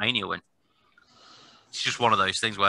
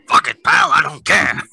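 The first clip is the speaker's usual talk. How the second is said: dismissive Vince voice; 5 semitones higher, 10 dB louder, slower than usual. Retold or imitated. Mocking Vince's indifference.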